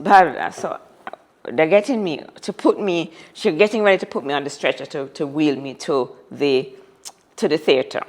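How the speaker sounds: ordinary talk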